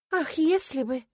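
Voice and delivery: high, wistful lament; mock-sad sigh. A narrator's reading. Heroine answering her rival's jibe, sadly sighing. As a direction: sigh it out with showy sorrow. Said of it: "печально вздохнула я".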